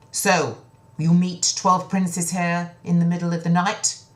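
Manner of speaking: loud, pointed question; accusing king. Acted. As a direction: catch them out. King confronts daughters.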